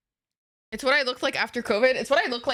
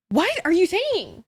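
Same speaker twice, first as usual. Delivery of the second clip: high, slow, drawn-out disbelief; baffled outcry; 8 semitones higher, 4 dB louder than usual. Real, not acted. Streamer answering a confusing chat message, baffled outcry. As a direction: stretch it out high in bewilderment.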